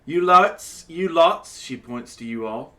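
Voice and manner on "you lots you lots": loud, higher, rising calls; barmaid calling out. Acted. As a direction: grab the table's attention. Barmaid addressing the party.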